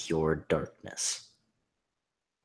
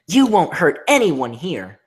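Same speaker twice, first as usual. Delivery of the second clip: defiant heroine; 11 semitones higher, 14 dB louder than usual. Acted. Twilight defying monster.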